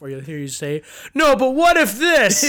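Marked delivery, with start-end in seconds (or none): 1.1-2.4 loud imitation. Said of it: "you'll just hear you laugh".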